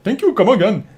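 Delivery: lightly put-on Indian shopkeeper accent; mock Indian accent. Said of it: "we want you to do it in an Indian accent".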